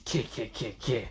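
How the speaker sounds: low, very soft staccato cackle; quiet little creepy-doll cackle; creature cackle. Acted. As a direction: cackle under your breath in short syllables. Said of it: "where the doll says".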